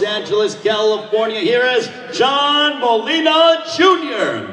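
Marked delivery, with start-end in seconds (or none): 1.4-4.5 higher, slow, stretched ring call; ring announcer. Real, not acted. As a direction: drag out the fighter's name like a ring announcer. Ring announcer calling the fighter.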